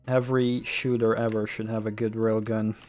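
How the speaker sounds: ordinary delivery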